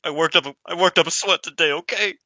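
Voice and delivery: high-pitched, loud protest, pitch climbing; mock indignant denial. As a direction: play the wrestler insisting it's only sweat. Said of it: "She's like".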